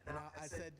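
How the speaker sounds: ordinary delivery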